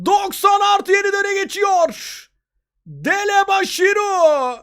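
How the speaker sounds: excited football commentator. Real, not acted. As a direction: relive the goal with excitement. Replay of the winning goal. (heyecanlı maç spikeri)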